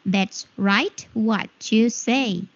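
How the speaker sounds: slow, word-by-word teaching demo; slow and clearly separated. Shown as a demonstration. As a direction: stretch each word out for learners. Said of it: "Oke, Miss perlambat lagi".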